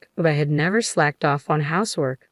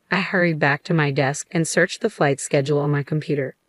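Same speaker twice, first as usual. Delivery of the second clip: plain reading voice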